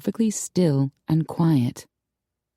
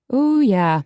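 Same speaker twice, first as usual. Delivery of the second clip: ordinary delivery, nothing marked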